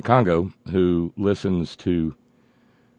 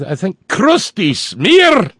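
slow, higher Scottish "crusty smear" tried out; Scottish accent; 12 semitones higher, 6 dB louder, slower than usual. Pretend. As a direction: lighter Scottish burr, drawn out. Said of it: "my Scottish accent".

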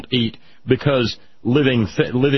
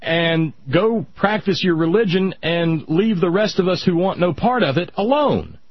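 raised pitch, dragging plea to stop; exasperated scolding; 7 semitones higher than usual. Pretend. Speaker to religious people, exasperated high-pitched scolding. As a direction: scold them like a fed-up neighbour. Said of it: "that we could say".

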